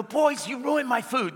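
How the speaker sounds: a hint of a whiny customer; mock complaining lady. Preacher mimicking the diner scolding the boys, slow and lightly put-on. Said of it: "She'd go".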